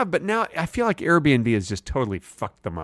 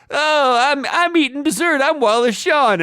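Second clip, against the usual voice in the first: much higher, louder silly voice; 13 semitones higher, 3 dB louder than usual. Retold or imitated. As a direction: squeal it out gleefully. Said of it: "he's like".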